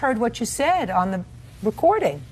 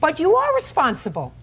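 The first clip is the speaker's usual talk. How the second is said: higher and louder, insistent; emphatic rebuttal; firm contradiction; 6 semitones higher, 4 dB louder than usual. Real, not acted. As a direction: push back hard on the claim. Judge contradicts the manager.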